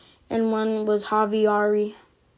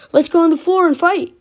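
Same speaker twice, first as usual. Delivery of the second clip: raised, louder voice imitating a challenger; higher, loud retold dare to fight; acted taunting challenge; 5 semitones higher, 5 dB louder than usual. Kid challenging the teller on the playground, mock loud dare.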